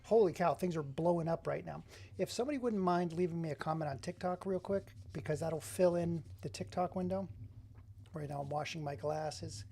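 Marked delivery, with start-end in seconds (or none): none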